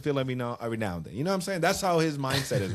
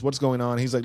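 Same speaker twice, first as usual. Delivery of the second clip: ordinary talk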